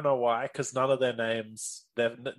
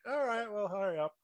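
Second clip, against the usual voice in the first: mock wife; 9 semitones higher, 5 dB quieter than usual. Wife voiced in retelling.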